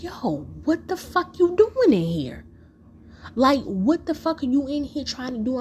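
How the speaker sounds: high, sharp demand to an intruder; confronting yell. Retold or imitated. Speaker to Rob behind grandmother's bed, a high sharp demand.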